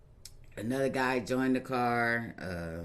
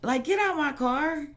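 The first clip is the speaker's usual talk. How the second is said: pitched way up, shocked and indignant; startled high protest; 6 dB louder than usual. Retold or imitated. To the gunman in her car, high shocked protest. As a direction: cry out in disbelief at the carjacker. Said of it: "I'm like".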